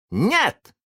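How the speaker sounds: put-on high scornful boyish no; contemptuous. A narrator's reading. Boy answers the pirate's guess, contemptuous. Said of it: "в голосе Питера зазвучало презрение".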